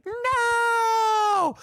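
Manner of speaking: high, loud, stretched-out "no"; mock outcry of a whole class, long and high; drawn-out shouted no. A class reacting to the teacher's news, high drawn-out shout.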